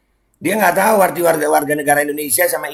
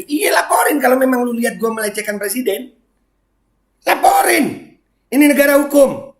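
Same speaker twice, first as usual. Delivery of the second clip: angry shout; 7 semitones higher than usual. Real, not acted. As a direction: yell back at the accuser to report him. Shouting back at accuser. (teriakan marah, jauh lebih tinggi dan keras)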